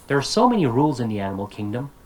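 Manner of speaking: fast, unbroken chunk, "kingdom" left rising. Shown as a demonstration. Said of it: "here I went up because I'm not done".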